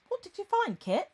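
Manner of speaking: high-pitched, feminine English governess voice; androgynous, feminine voice. Acted. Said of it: "decidedly feminine".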